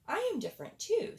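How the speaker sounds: high, fast little hedgehog voice; squeaky hedgehog. Acted. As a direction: pipe up quick like a tiny hedgehog. Hedgehog speaks up.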